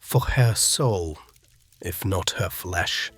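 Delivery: slow, loud, solemn priest; weighty, commanding prayer call; steely piety. A narrator's reading. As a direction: intone it piously with steel underneath. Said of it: "carrying an edge of steel beneath its pious tone".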